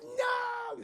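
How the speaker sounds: high, mock-anguished cry; grieving cry. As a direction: wail in hammed-up grief. Son grieving dead father.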